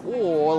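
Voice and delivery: "oh" as a loud, rounded Russian vowel. Shown as a demonstration. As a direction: give "oh" a full Russian O. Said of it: "You have to say".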